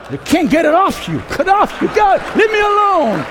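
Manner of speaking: high, loud struggle to shake something off; hammed-up frantic shout; mock desperation. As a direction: cry out as if it won't let go. Acts listener stuck with the sermon.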